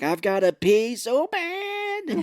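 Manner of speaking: high, drawn-out squeal; hammed-up urgency, stretching the words out; mock desperate whine. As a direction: wail it like you're about to burst. Acted desperate character in film retelling.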